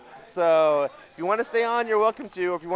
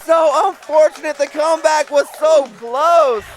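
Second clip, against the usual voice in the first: excited play-by-play; 9 semitones higher, 8 dB louder than usual. Real, not acted. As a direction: call the final kill at full volume. Play-by-play at the climax.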